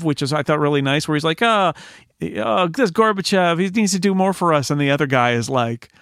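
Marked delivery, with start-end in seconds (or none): 1.4-4.7 higher-pitched grumble of an ordinary citizen; mild complaining. Acted. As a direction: act out a man griping at a newsstand, higher than usual. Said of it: "complaining about Gorbachev".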